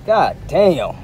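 ordinary delivery

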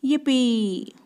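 loud, high, drawn-out cheer; braying cheer. A narrator's reading. Goat offered all the food, braying cheer. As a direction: whoop it out like a happy goat. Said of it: "brayed Goat".

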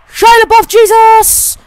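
high, full-volume yell; loud, high hyped-up holler; battle-cry shout. Pretend. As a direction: scream it like a war cry. Yells his made-up battle name.

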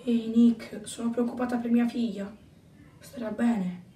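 hushed, low, worried voice; quiet worry. Acted. Mother asks Nick about her daughter, hushed and worried. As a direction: confide it very softly.

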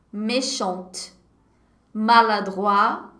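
ordinary talk, nothing marked

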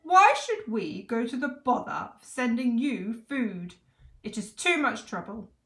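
shouting king. A narrator's reading. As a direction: shout like a put-out monarch. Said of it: "shouted the fat king".

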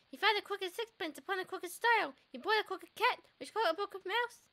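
lilting rhyme read with a slight lift; nursery rhyme reading. Acted. Player reading the rhyme aloud to the viewers, lilting. Reads the crooked man rhyme.

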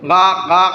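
short caws, crow-like; crow caw imitation. Shown as a demonstration. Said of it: "মনে করো আমি উচ্চারণ করতেছি". (কাকের ডাকের নকল, গা গা)

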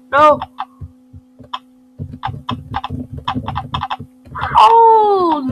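sudden high yelp as it goes wrong; a real shock at the screen; alarmed cry. Real, not acted.

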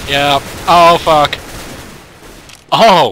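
loud, high yelps in a firefight; startled shouts. Real, not acted. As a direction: shout in surprise mid-fight. Sudden firefight.

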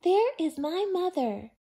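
high, loud excited call; happy loud bird cry; excited shout. A narrator's reading. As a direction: shout it with relief. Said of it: "he said".

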